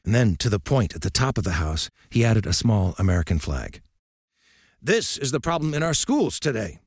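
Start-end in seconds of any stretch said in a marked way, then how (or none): 4.8-6.8 put-on lightly raised, gruff old coach lecturing; old coach's voice. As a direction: speak as a stern old baseball coach.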